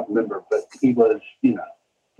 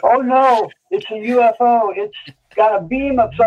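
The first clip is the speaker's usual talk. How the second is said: mock alarm; 10 semitones higher than usual. Playful UFO bit, much higher.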